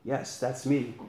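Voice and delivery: a hushed divine voice, unhurried; voice of God. A narrator's reading. God calls down to Jack on the cliff, quiet and calm.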